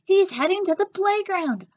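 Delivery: high, loud calling out; shouting directions; shouted. A narrator's reading. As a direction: warn everyone where he's going. Vice principal keeps shouting.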